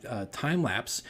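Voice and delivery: ordinary delivery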